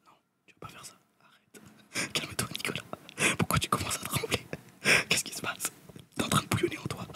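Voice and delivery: hushed whisper, urging himself to stay calm; whispered self-talk. Acted. Telling himself to stay calm over the price, whispering. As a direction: whisper to yourself like a jittery conscience.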